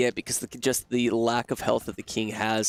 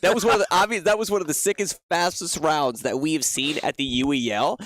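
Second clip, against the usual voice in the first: esports caster; 3 semitones higher than usual. Real, not acted. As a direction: gush over the play. Hyped play-by-play recap.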